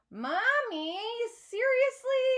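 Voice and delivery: high, loud whiny protest; whine. A narrator's reading. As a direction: whine at mom like a teen. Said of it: "I whine".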